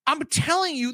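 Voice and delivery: normal talking voice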